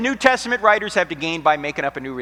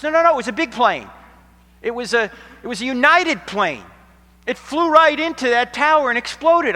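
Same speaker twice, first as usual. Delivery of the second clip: alarmed; 8 semitones higher, 4 dB louder than usual. Retold or imitated. Pastor reporting the crash, alarmed.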